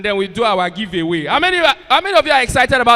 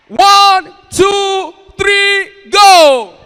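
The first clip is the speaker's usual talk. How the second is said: much higher, much louder count; shouted countdown; 9 semitones higher, 11 dB louder, much slower than usual. Real, not acted. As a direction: shout the count to rally the crowd. Host counts in crowd cheer.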